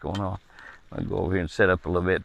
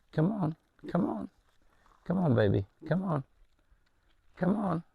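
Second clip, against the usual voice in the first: soft, higher sweet-talking call; coaxing animals; 6 semitones higher, 6 dB quieter than usual. Real, not acted. Man coaxing a shy deer to his hand, soft and high. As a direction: coax them gently like calling a pet.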